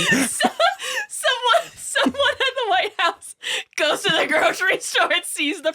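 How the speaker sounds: laughing. Real, not acted. Laughing while telling.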